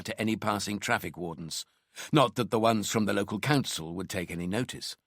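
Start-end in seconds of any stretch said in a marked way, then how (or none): none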